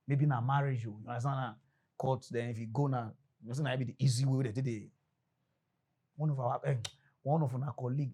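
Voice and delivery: ordinary talk, nothing marked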